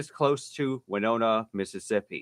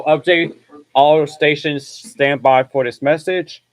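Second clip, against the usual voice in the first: loud, slow emergency broadcast call; terse radio update; 3 semitones higher, 9 dB louder, slower than usual. Acted. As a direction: announce it like a station alert. Urgent broadcast alert.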